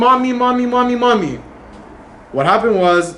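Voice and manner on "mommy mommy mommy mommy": high-pitched mock cries of mommy; children yelling mommy. Rabbi retells the rescued kids crying out, raised pitch. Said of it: "two hundred kids start yelling".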